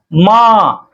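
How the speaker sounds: long prolonged call of माँ; माँ stretched out as a pluta call; drawn-out call. Shown as a demonstration. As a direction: hold the vowel of माँ like calling far off. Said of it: "अगर हम माँ को पुकार दिए ज्यादा देर तक".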